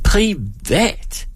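high, drawn-out incredulous echo; mock shock. Performer throws her word back, high mock shock. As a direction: swoop up into a scandalised question.

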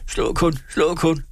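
high-pitched cry without volume; understated scream, pitched high in desperation; frantic cry. A narrator's reading. Peasant daring the forester to strike, frantic cry. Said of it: "skreg bonden ud af sig selv".

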